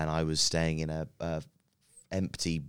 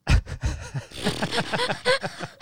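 high, breathy burst of laughter; helpless high-pitched laughing; 4 dB louder than usual. Real, not acted. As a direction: laugh out loud, high and airy. Laughing after the sad story.

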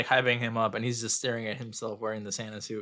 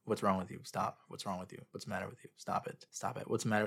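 quiet monotone; 4 semitones lower, 10 dB quieter than usual. Retold or imitated. Imitating creepy Santa's repeated lines.

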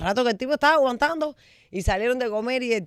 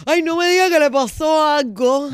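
mock Penélope Cruz; 5 semitones higher, 6 dB louder than usual. Recalling her old sketch, a mock Penélope Cruz lament. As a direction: ham up a Spanish actress's worried gasp. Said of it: "el personaje que hiciste ... de Penélope Cruz".

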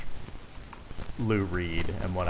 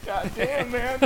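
excited exclamation; slower than usual. Real, not acted. Exclaiming in delight.

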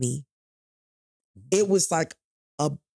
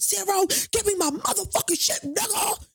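pitched way up, ranting mock version of her demand; exaggerated mock outburst. Asking her boyfriend for her work things, as a hammed-up high rant. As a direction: overplay it like a crazed demand to show his overreaction. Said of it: "You would have thought that I said".

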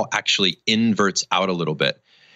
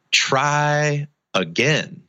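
slow encouragement; 5 semitones higher, much slower than usual. Retold or imitated. Worker encouraging boy up wall.

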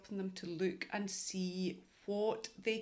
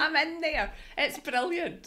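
loud, high and eager; excited; 8 semitones higher, 6 dB louder than usual. Real, not acted. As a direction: gush about it happily. Excited about shared course.

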